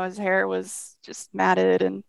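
usual speaking voice

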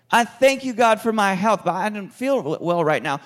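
loud, high, fervent thanks to God; praising at full voice while feeling unwell; loud praise prayer. Acted. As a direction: shout thanks to God through sickness.

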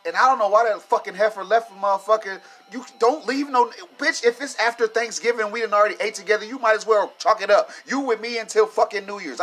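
loud, high-pitched furious rant; heated, raising the voice in outrage; angry rant. Real, not acted. Ranting to the audience about his brother's ex, loud and furious.

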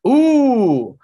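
high, loud delighted ooh; excited ooh. Real, not acted. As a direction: squeal it out, impressed. Reacting to a guess.

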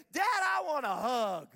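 put-on slow, loud, heartfelt kid plea; kid asking hug. Imitating a child pleading to dad for a hug, slow and loud. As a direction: ask dad for a hug with all your heart. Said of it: "took their open hands and said".

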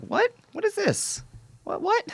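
very high-pitched bewildered squeal; mock astonishment. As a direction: squeak it out as a baffled listener. Imagines his astonished reaction on discovery.

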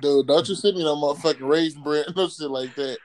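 ordinary delivery

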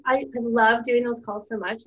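ordinary delivery

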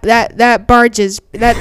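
plain reading voice

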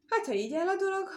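high, loud, quick storybook musing; saying to himself. A narrator's reading. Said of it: "mondta magába a muzsikus".

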